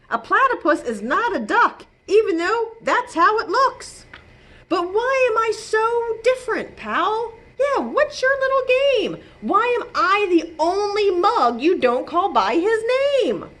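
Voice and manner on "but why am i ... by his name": indignant. Acted. Warren protests indignantly.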